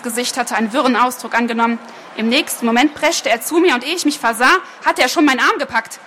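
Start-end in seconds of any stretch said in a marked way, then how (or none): none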